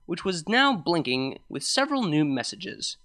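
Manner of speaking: ordinary delivery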